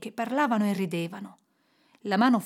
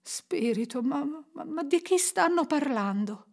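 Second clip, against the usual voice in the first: trembling question; 5 semitones higher than usual. A narrator's reading. Scrooge asks the spirit who they mean, trembling. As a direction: falter as if afraid to ask. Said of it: "chiese Scrooge tremante".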